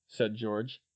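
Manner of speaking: ordinary delivery, nothing marked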